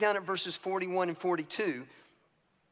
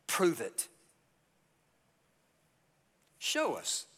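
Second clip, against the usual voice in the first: mocking challenge. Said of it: "they're challenging him".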